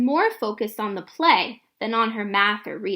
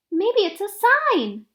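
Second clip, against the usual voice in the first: put-on hopeful little girl; 8 semitones higher, 4 dB louder than usual. Girl hopes about heart rock.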